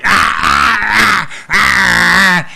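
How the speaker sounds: loud, high, repeated shouted roars; wild ring screams. Retold or imitated. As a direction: imitate a crazed wrestler screaming in bursts. Imitating Luna's wild ring persona.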